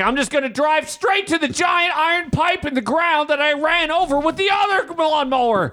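very high, shouted goofy voice; teasing falsetto shout as husband; mock Andrew. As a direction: ham up a clueless husband. Voicing Andrew's plan mockingly.

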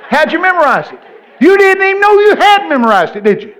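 high, loud incredulous challenge; exaggerated disbelief at full voice; hollered mock amazement. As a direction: shout it at the congregation like a revelation. Hammers the point at audience.

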